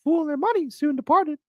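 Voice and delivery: high cartoon mouse voice; Mickey Mouse voice. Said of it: "how about with Mickey".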